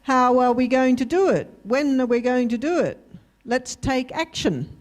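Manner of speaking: ordinary talk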